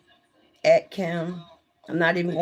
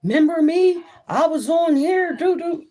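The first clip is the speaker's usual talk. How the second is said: high, loud mocking fan voice; 11 semitones higher, 6 dB louder than usual. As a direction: squeak it out like a needy viewer. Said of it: "Had, saying".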